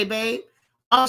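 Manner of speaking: ordinary talk, nothing marked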